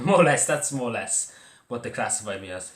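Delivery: ordinary talk, nothing marked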